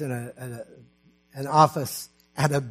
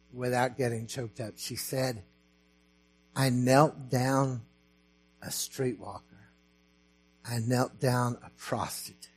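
choked up; 3 semitones lower, 7 dB quieter than usual. Real, not acted. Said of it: "I may lose it now".

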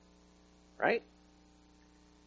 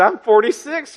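shocked disbelief; 10 semitones higher, 7 dB louder than usual. Retold or imitated. Retelling his shocked reply to wife.

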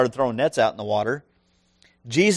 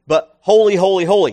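"holy, holy, holy" each hit louder, building; emphatic triple holy; 4 semitones higher, 6 dB louder than usual. Shown as a demonstration. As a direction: stress every "holy", louder each time. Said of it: "God is holy to the superlative degree".

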